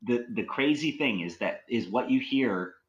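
ordinary delivery

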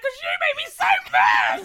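very high, loud hammed-up shriek; mock mad outburst. Acting out her outburst at her fiance, mock furious shout. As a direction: drag out an exaggerated tearful-mad yell. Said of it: "start getting mad".

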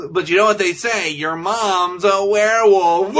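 hammed-up B-movie punchline; mock cheesy movie line.

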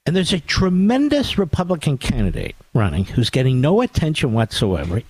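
usual speaking voice

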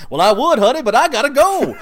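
high, loud comic impression of a movie line; jokey film quote. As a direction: toss it out as a hammed-up exit line. Acting out the film's joking exit line.

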